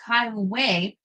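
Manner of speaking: ordinary delivery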